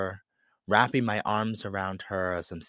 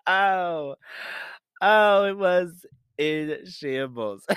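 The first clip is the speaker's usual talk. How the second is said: high, louder words through real laughter, drawn out slowly; laughing through words; 9 semitones higher, 6 dB louder, slower than usual. Real, not acted. Recalling her life in shambles while laughing. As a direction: still laughing as she repeats it.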